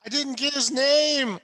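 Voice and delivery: high, loud comic whine; plaintive protest. Acted. Player as wounded hero to his rescuer, plaintive protest. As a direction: wail it as a mock complaint.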